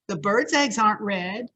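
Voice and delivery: usual speaking voice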